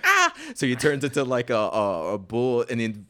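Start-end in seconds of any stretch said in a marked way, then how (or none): 0.0-0.3 high laughing shriek of panic; mock scream. Retelling the cartoon fight, a high panicked shriek. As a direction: shriek like a spooked cartoon dog. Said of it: "So he's like".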